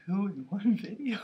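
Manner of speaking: whisper. Real, not acted. Unvoiced whisper reading.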